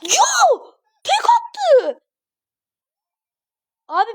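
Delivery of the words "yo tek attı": very high-pitched cry of disbelief; shocked outcry. Real, not acted. Gamer gets knocked out in one shot, shocked outcry. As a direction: shriek it in dismay.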